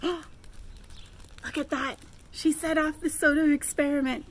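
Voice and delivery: usual speaking voice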